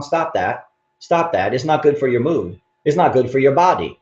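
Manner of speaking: usual speaking voice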